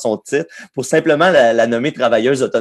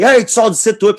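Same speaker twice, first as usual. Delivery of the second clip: retold shout; 10 semitones higher, 4 dB louder than usual. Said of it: "il a comme crié".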